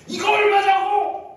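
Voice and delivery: high, drawn-out insistent yell; shouting. Acted. Grandpa pressing startled staff for the price, shouting. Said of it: "막 소리를 지르니까".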